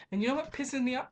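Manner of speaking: normal talking voice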